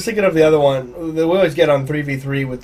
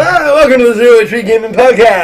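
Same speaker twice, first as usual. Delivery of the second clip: loud, high cheesy radio host; 6 semitones higher, 9 dB louder than usual. Pretend. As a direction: ham it up like an awful DJ. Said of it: "awful radio D J voice".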